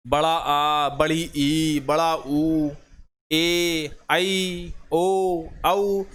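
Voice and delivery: बड़ा आ, बड़ी ई, बड़ा ऊ, each vowel held long and half-sung; sing-song drawn vowels for a class. Shown as a demonstration. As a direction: stretch every vowel like a teacher showing length. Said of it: "दीर्घ स्वर कहलाते हैं".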